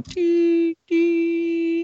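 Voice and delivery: high, flat imitated heart-monitor beeps; mimicking a life monitor's tone. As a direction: beep like a hospital machine.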